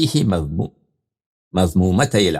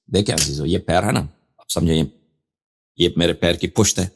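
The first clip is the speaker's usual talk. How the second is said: ordinary talk, nothing marked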